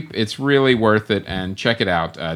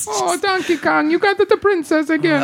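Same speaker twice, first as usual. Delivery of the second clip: cartoon Italian accent. Game hero complaining to Donkey Kong, cartoon Italian accent.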